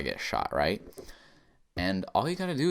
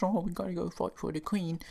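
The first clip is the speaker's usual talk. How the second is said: mock British subject; 9 semitones higher, 4 dB quieter than usual. Mocking Canada's loyalty as British subjects, high put-on voice. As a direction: mimic a dutiful subject in a higher, put-on voice. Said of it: "because they were British subjects".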